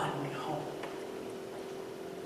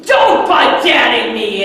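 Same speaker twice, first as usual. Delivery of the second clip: louder, slow cutting-off retort; angry snap; 5 semitones higher, 12 dB louder, slower than usual. Retold or imitated. Father cutting off his daughter's protest, angry and loud. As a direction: shut the child down hard.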